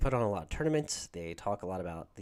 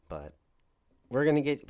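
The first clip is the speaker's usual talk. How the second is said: usual speaking voice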